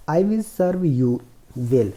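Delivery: slow, careful reading of the English passage; slow reading aloud. Shown as a demonstration. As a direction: read each word slowly for the class. Teacher reads story slowly. (धीमी आवाज़ में अंग्रेज़ी पढ़ना)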